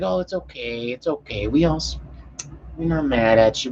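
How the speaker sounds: soft, imitated reassurance; soothing. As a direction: play a cop hushing someone upset. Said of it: "Chick's freaking out, crying".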